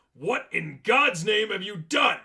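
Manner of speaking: high, loud and slow, horrified; shocked outrage. Acted. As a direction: bellow it at the man who wrecked everything. Manager finds wrecked animatronics.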